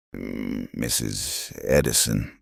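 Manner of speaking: a hint of a Norwegian farmer touching his hat; soft Norwegian-accented greeting; lilting Norwegian accent. A narrator's reading. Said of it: "Magnus said in his lilting accent".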